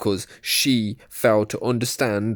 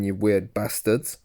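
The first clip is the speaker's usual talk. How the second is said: ordinary talk, nothing marked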